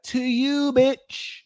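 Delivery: high, mocking "To you, bitch"; sassy comeback. Shown as a demonstration. Advising viewers on comebacks, high sassy retort. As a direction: toss it right back at them. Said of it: "turn it back to them and be like".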